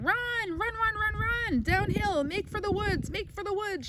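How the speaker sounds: high urgent goose holler. Acted. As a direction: urge the pig to flee. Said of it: "she too started hollering".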